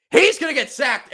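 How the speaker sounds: excited shout. Real, not acted. Live reaction to the sack.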